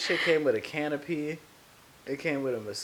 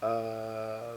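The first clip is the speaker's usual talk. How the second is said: soft, measured public-radio voice; NPR voice; 2 semitones lower, 6 dB quieter than usual. Acted. Podcaster musing on why pets give hope, in a soft NPR voice. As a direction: speak softly, like an NPR host. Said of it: "This is my N P R voice".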